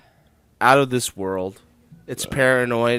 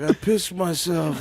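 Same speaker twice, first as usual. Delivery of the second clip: higher, slow mock confession; 5 semitones higher, slower than usual. Host imitates singer wandering the house, slow and befuddled. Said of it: "He's just walking around the house like".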